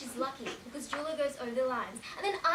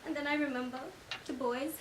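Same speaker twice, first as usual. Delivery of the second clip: ordinary delivery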